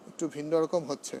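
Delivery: normal talking voice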